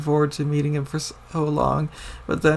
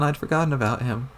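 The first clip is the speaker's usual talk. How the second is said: ordinary delivery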